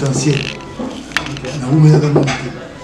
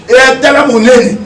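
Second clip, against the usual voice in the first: loud and slow, each word weighed; loud slow emphasis; 8 semitones higher, 6 dB louder, slower than usual. Real, not acted. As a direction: hammer it home, slowly and loud. Emphatic rebuke, drawn out. (voix forte et lente, appuyée)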